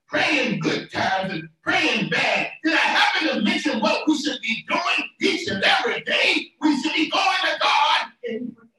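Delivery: rhythmic, building sermon cadence; preaching. Real, not acted. Preacher urging the congregation to pray always, full-force preaching.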